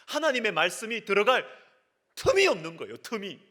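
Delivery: high-pitched, pressing exhortation; impassioned preaching. Acted. Preacher describing lives too busy for God, impassioned. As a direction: pile up the worries with urgent intensity.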